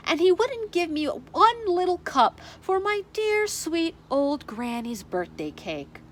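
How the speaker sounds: ordinary delivery, nothing marked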